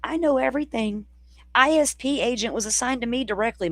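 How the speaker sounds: high, smug know-it-all voice; mocking a know-it-all. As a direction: parody her bragging, nose in the air. Mocking WSU Mom Kim's claims.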